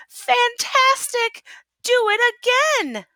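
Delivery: excited shout. A narrator's reading. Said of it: "Elizabeth shouted".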